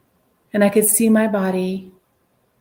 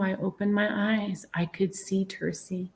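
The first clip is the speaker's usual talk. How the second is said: whispered, voice dropped to a breath; soft whisper on waking; hushed whisper; 6 dB quieter than usual. Retold or imitated. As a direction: breathe it out softly, as if just waking.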